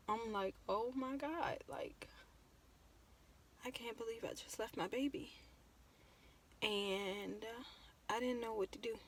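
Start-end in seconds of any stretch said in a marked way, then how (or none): 0.7-5.3 very soft, heartbroken disbelief; retold breakdown. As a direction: let the voice sink as you relive breaking down. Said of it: "I broke down".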